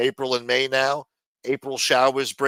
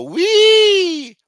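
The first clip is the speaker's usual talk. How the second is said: long, loud, very high-pitched "wee"; gleeful wave-riding whee; 11 dB louder than usual. Retold or imitated. Boogie boarder imitating a ride on a wave, high whee. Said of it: "I couldn't even go".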